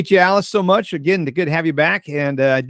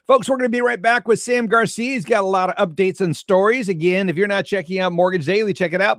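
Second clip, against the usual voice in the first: upbeat, higher show host sign-off; radio host; 6 semitones higher than usual. Acted. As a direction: announce the break brightly. Host break announcement.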